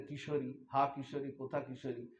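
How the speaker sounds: calling the name softly, with longing; a lament barely above a breath; soft grieving call. Acted. Grieving young man softly calling his lost wife.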